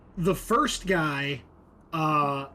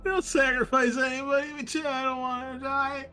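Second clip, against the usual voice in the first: terrified captive; 11 semitones higher than usual. Acted. Captive hears about the sacrifice and panics. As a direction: scream that you don't want to die.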